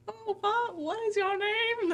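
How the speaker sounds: high, slow mock Southern aunt; Southern accent. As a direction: repeat his squeaky Southern impression. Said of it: "That was actually really funny".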